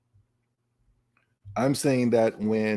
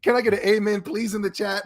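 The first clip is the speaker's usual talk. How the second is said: calling out to chat; 8 semitones higher than usual. Real, not acted. Streamer calls out to chat.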